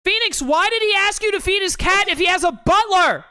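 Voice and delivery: loud exasperated outburst. Real, not acted.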